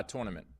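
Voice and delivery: "tournament" with first syllable like "tour". Shown as a demonstration. New Yorker shows how he says "tournament", as TOR-nament. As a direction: say "tournament" starting TOR, not TUR. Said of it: "I usually say".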